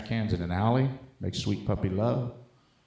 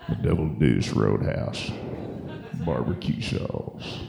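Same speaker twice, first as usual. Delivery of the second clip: low, slow gravel-cowboy drawl; slow and deep like a laconic cowboy; Sam Elliott; 7 semitones lower, slower than usual. Retold or imitated. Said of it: "talked like Sam Elliott".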